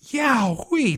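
put-on high-pitched yowl of a little boy; child's scream. A narrator's reading. Tommy steps into the cold river, screaming. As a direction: yelp at the shock. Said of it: "Tommy screams".

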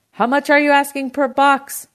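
loud, high shout down the phone. A narrator's reading. Uncle to produce man over the phone, shouting. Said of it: "my uncle shouted over the phone".

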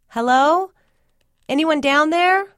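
high, loud call into the dark cellar; a loud, high, uncertain call into the darkness. Acted. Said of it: "I called".

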